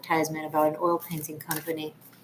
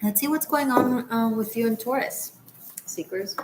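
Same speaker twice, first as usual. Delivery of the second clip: usual speaking voice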